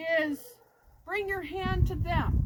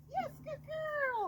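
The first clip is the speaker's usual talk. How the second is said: high praise; 11 semitones higher, 4 dB quieter, slower than usual. Real, not acted. Praising dog in training.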